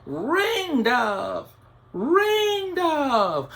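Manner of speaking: high, loud calling out; screaming her name. Acted. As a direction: call her name from far away. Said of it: "They were screaming out her name".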